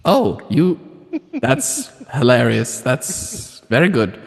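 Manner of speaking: high crone's voice, flirting. Acted. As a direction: coo at him like an old crone.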